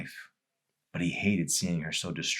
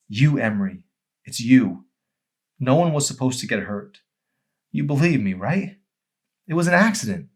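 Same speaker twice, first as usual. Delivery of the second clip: anguished; 6 semitones higher, 6 dB louder than usual. Acted. Arson's anguished confession.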